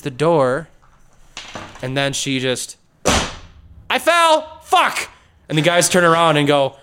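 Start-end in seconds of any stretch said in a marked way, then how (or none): 3.9-5.1 yell. Retold or imitated. Character falls, yells.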